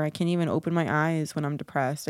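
ordinary talk

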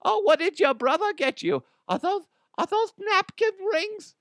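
high, innocent mother voice; chirpy mom asking sweetly; mother innocently asking about the gift; mock mom voice. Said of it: "My mom's like".

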